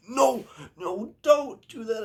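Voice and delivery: high-pitched retold plea; mock pleading. Friend begs him not to serve the brownie, mock pleading.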